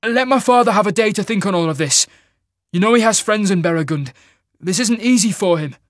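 high, fast, loud begging; pleading. Acted. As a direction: hurry to plead for your father. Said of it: "pleading with the Mage Lord, begging him".

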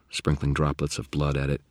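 plain reading voice

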